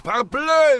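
Parbleu in a high, loud, mock French accent; French exclamation. De Vere exclaims Parbleu in mock French to the colonel. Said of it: "wie der Franzose sagt".

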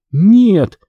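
high-pitched protesting refusal; resisting. A narrator's reading. Girl being pulled toward fire, resisting. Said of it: "сопротивлялась та".

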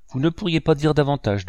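mildly delighted exclamation, starting high; exclaiming. A narrator's reading. Silver, given hope by the doctor, mildly exclaiming. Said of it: "s'écria-t-il".

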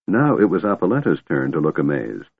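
usual speaking voice

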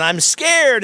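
high-pitched imitation of a frightened little girl; mock-scared child's plea, pitched well up; scared daughter. Preacher acting his daughter on the roller coaster, mock-scared. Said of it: "it was like".

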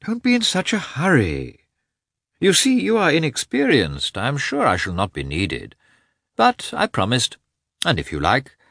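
doctor calming a nervous husband. A narrator's reading. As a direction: reassure him, unhurried. Doctor calmly soothing anxious husband.

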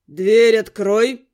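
slow, low, loud man's order; menacing, drawn-out male command; angry demand. A narrator's reading. Angry man demanding entry.

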